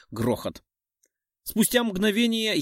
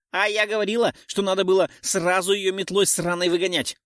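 high, sharp little pixie; squeaky indignant pixie butting in; shrill pixie voice; 6 semitones higher than usual. A narrator's reading. Chopla pixie butts in.

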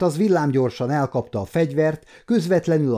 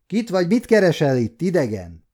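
put-on higher, louder challenge from an old guard; snapping at him; 5 semitones higher, 3 dB louder than usual. Old gate guard challenges the stranger, loud and harsh. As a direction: bark it at the stranger. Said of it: "rivalt rá az idősebbik".

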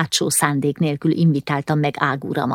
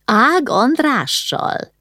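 slow, higher startled cry; cried out; 8 semitones higher, much slower than usual. A narrator's reading. Mother hearing the name, crying out in slow alarm. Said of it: "kiáltott fel Horvátné".

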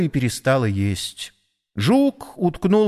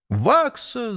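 calling out; 10 semitones higher than usual. A narrator's reading. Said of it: "закричал воробей".

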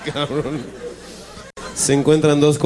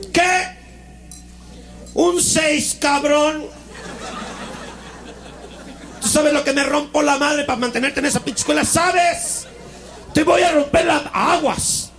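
much higher mock furious rant; angry father's squeaky rant; 13 semitones higher, slower than usual. Father yelling at his son over a bad grade, hammed-up fury. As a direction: rant like an outraged dad, hammed up.

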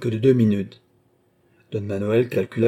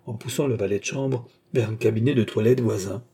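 ordinary delivery, nothing marked

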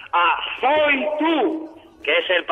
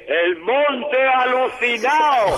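slow and higher, like a fairground echo; fake echo; 6 semitones higher, much slower than usual. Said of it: "me encanta el eco pillado de la feria".

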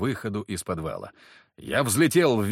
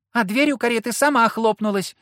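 put-on much higher, louder child's squeak; squeaky little boy; 12 semitones higher, 7 dB louder than usual. A narrator's reading. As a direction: pipe it up like a small kid. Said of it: "пропищал чей-то высокий голос".

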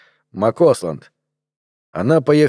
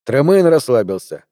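ordinary delivery

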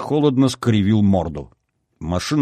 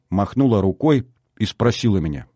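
usual speaking voice

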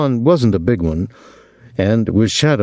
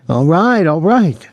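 high, pained outburst, moderately raised; shouting; 8 semitones higher, 4 dB louder than usual. A narrator's reading. Rejected boy giving up on the cook, shouting. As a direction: give in with a high, frustrated cry. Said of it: "he shouted".

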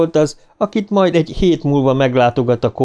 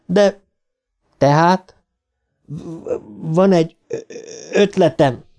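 hesitant, higher-pitched stammer; dadogás; 6 semitones higher than usual. Acted. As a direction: stammer haltingly through the words. Bill stammers out an idea. (akadozó, dadogó beszéd)

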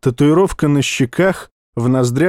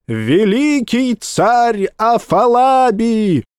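town crier; 8 semitones higher, much slower than usual. Acted. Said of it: "орал тщедушный, тощий как глист, глашатай".

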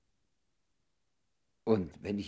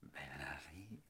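tender whisper; 11 dB quieter, slower than usual. Acted. Lovers reunited, tender calling.

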